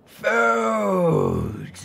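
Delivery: high, loud creature shout; a hungry dragon roars; loud dragon bellow. A narrator's reading. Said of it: "one of the dragons bellowed".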